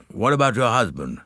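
mildly hesitant question. A narrator's reading. General asking wife about bridge, slightly hesitant. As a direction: ask it a little tentatively.

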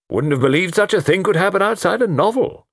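high, emphatic exclamation from the Colonel; incredulous old-soldier character; blustering Colonel. Acted. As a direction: declare it loftily, pitch pushed well up.

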